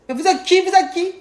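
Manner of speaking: high-pitched, demanding imitation of a caller; mock menacing caller. Retelling the girl's threatening call to husband, mock menacing. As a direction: challenge them like a pushy girl on the phone. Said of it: "une fille qui me menace".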